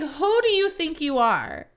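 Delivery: outraged. Retold or imitated. Retelling her outraged reaction.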